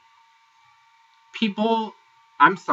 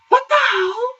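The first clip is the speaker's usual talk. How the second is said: high shrieking laugh of disbelief; 5 dB louder than usual. Real, not acted. Recapper shrieking with laughter at Natalie's jab. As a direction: burst out in a shocked high laugh.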